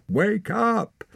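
very high, loud cry; urgent shout. A narrator's reading. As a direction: shout to shake someone awake. Shaking her awake.

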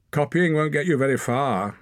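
high taunting call; Wren calling out. A narrator's reading. Wren calls mockingly to Torak, high teasing call. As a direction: call it out teasingly across the ring. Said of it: "called Wren".

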